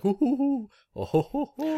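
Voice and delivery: high, put-on sly chuckling; imitated shopkeeper chuckle. Guest imitates the charm maker's giggle, high sly chuckle. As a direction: mimic the giggling shopkeeper bug. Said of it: "you can kinda hear them, like, laughing".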